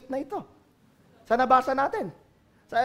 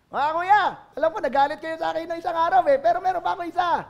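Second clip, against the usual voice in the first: higher, louder, fast eager little brother; eager Joseph; 6 semitones higher, 5 dB louder, faster than usual. Acted. Joseph telling brothers about another dream, fast and eager. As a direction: rush it out, excited and cheeky.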